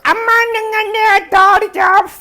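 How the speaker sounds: very high, loud shocked shriek; hammed-up scream of discovery. Wife finds the idol smashed, hammed-up shriek.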